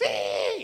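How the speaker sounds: loud imitated monkey screech; monkey shriek. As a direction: squeal like a monkey being hit.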